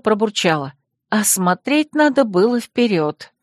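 ordinary delivery, nothing marked

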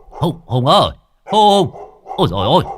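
loud frightened cries; panicked calling. Acted. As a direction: shout for help as the dog charges. Scared by charging dog. (hốt hoảng gọi to)

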